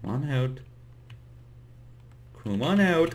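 ordinary delivery

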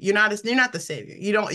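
ordinary delivery

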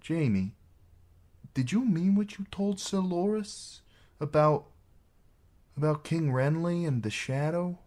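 high-pitched, halting maid; hesitant. A narrator's reading. Maid asking the knight about King Renly, hesitant. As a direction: feel your way into the question, voice lifted.

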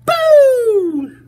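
long, high, loud boo; mouse's shout. Acted. Mouse jumps out to scare the elephant, shouting. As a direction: startle them with a drawn-out shout. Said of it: "shouted a little gray mouse".

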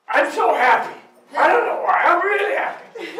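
high, loud burst of joy; elated. Retold or imitated. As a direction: gush with giddy happiness. Husband comes home elated.

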